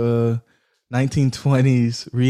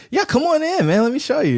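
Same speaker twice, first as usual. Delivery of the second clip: high, bright invitation, pitched way up; eager welcome; 14 semitones higher than usual. Retold or imitated. To the builder at his door, an eager, high-pitched welcome.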